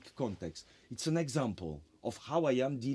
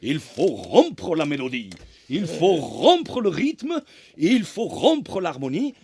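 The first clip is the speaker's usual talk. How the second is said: put-on French with a heavy German accent, much louder, proclaimed; Lachenmann's German-accented French; 3 semitones higher, 10 dB louder than usual. A teacher proclaiming to his seminar, in German-accented French, loud. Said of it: "speaking in French with a German accent".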